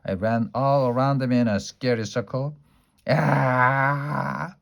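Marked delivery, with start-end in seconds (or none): 3.1-4.6 a playful made-up scream to scare. A narrator's reading. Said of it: "I screamed".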